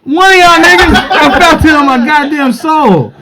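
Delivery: high, loud, laughing outrage; loud laughing complaint. Real, not acted. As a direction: shout it while laughing. Complaining about spit.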